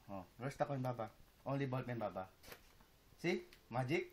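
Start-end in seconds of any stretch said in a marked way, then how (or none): none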